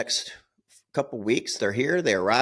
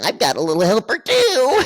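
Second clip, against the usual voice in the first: mock wife retort; 11 semitones higher, 7 dB louder than usual. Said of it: "she goes".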